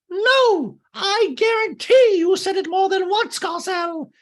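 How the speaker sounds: very high, loud squeak; hammed-up Dorit, squeaky and loud; mock Dorit. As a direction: shriek it in a high-pitched squeal. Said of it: "she was like".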